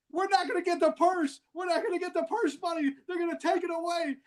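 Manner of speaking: high-pitched imitation of a complaining brother; retold yelling. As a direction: wail it high without real volume. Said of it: "starts yelling out".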